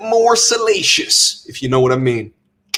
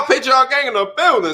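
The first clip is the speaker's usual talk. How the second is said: hype; 9 semitones higher than usual. Real, not acted. Hype announcement.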